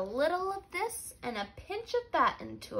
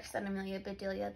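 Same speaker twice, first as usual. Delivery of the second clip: ordinary delivery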